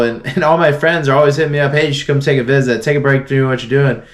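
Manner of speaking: ordinary talk, nothing marked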